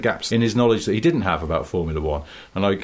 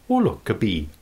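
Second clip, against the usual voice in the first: mock Welsh accent. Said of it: "a Welsh accent is entirely geared around saying".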